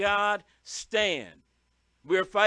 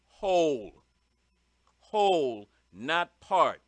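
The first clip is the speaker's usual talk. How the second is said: whole said louder and low, with a falling tune; stress on whole; 4 semitones lower, 5 dB louder, slower than usual. Shown as a demonstration. Stressing whole armor to the listeners, emphatic whole. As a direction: punch whole, then set not part against it. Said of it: "two things I emphasize here".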